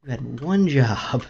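frustrated scolding. Real, not acted. Gamer yells at the pet that got them killed. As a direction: tell it off for ruining everything. Scolding after dying in game.

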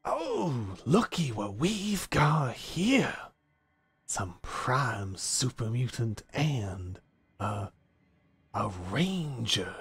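slow, swooping, drawn-out drawl; folksy menacing stranger. Acted. Game master as hungry stranger sizing up intruders, drawling menace. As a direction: toy with the newcomers, stretching the key words.

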